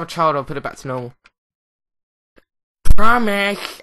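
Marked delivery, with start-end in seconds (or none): 2.8-3.8 loud emphatic promise; loud exclamation. Real, not acted. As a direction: belt out the promise. Vows to restore game setting.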